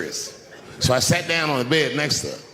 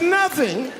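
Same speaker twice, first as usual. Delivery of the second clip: very high, stunned one-word question; mock disbelief; 14 semitones higher than usual. Husband feigning shock that the box was empty. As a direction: play innocent shock. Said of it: "I said".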